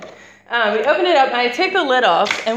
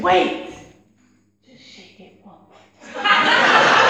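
put-on gleeful kid brother; 5 dB louder, much slower than usual. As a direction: stretch it out, barely holding in the laugh. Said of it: "he goes".

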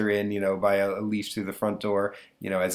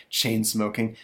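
normal talking voice

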